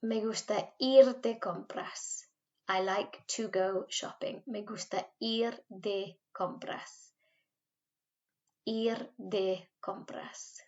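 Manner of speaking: Me gusta ir de compras, slow and clearly spaced; slow it right down for repeating; slow drill. Shown as a demonstration. Teacher models slowly for pupils to repeat. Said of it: "teacher drills phrases slowly".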